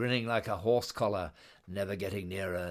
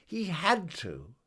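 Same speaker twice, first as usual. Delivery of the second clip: emphatic refrain; 8 semitones higher than usual. A narrator's reading. Narrator's refrain.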